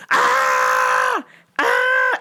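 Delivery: hammed-up customer scream; mock screaming. Customer refused the restroom, mock screaming. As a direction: scream twice, imitating her outburst. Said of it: "Just starts screaming".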